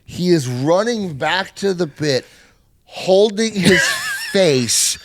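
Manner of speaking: ordinary talk, nothing marked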